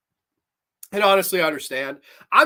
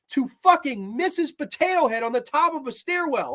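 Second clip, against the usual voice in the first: real exasperated outburst, pitched high; worked up and indignant, high-pitched; worked-up rant; 6 semitones higher than usual. Real, not acted. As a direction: rant in disbelief, voice pushed high. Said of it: "I get fucking a little too worked up sometimes".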